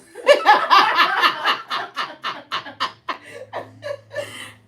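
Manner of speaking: loud, high-pitched helpless laugh. Real, not acted. Mom cracking up at her son's prank call, loud laughter.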